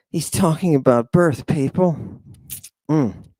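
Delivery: ordinary talk, nothing marked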